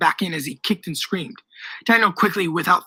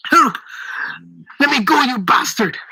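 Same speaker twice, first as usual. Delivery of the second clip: squealing; 4 semitones higher than usual. A narrator's reading. Old man struggles in Daniel's grip, squealing. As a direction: squeal and protest at being grabbed. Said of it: "the man squealed".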